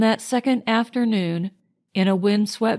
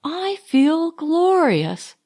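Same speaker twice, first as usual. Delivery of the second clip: higher, slow, savoured exclamation; cried out; 7 semitones higher, slower than usual. A narrator's reading. Marjorie echoing Leonard on the beach, savouring it. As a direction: stretch out the joy. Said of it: "cried Marjorie".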